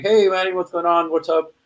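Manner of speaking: bright, high and loud greeting; cheery girls' greeting. Retold or imitated. Girls at the birthday party greeting Manny, high and bright. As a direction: hail a friend across the party.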